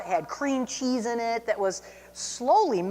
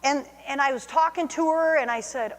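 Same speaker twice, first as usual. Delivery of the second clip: normal talking voice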